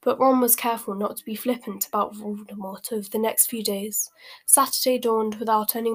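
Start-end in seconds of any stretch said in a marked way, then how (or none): none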